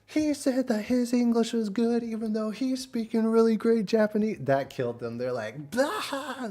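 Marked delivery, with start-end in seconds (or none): 0.1-4.4 high, loud hysterical imitation; hammed-up hilarity, pitched way up; losing their minds. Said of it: "they lost their minds". 5.7-6.5 high mock guffaw; hammed-up squeal of laughter; imitated laughter burst. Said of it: "They're just laughing their asses off".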